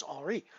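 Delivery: Henri in French, with a silent H; French pronunciation of Henri. Shown as a demonstration. Streamer showing the correct French name, Henri with silent H. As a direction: pronounce Henri the French way, dropping the H. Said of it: "I should try to pronounce it Henri".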